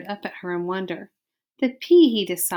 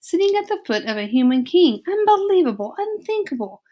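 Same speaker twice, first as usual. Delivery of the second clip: outraged father; 8 semitones higher, 4 dB louder than usual. Acted.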